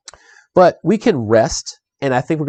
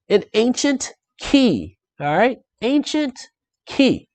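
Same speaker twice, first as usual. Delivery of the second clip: dramatic reveal; 10 semitones higher, slower than usual. Acted. Dramatic reveal of found item.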